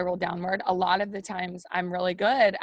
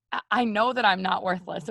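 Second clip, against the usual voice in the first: ordinary talk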